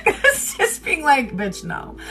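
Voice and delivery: laughing. Retold or imitated. Laughing sassy refusal.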